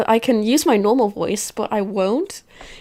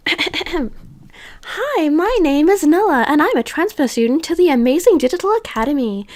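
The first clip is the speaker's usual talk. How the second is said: higher-pitched cutesy heroine narrating; cute anime girl; 5 semitones higher than usual. Acted. Transfer student introducing herself at a new school, cute anime voice. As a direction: put on a sweet anime schoolgirl voice. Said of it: "I need to do a really cute voice".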